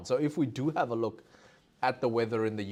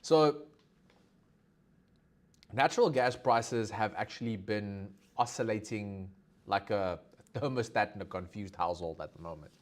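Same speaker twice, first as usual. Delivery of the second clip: ordinary talk, nothing marked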